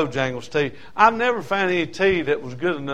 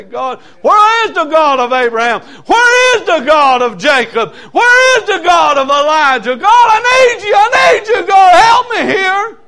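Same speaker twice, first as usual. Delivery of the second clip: desperate shouted prayer, much higher and louder; shouting up at heaven, near tears, begging for help; crying out to God; 14 dB louder than usual. Retold or imitated. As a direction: cry out to God from the woods. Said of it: "be crying out to God and say".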